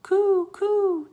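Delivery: high, loud cooing like a dove. Acted. Teddy showing off dove language with a high coo. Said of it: "Teddy said loudly".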